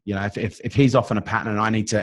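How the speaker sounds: normal talking voice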